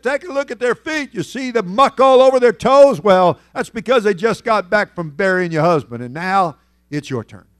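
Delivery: stern apostle. Retold or imitated. Peter confronting Sapphira over her husband, loud and stern. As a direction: thunder the judgment at her.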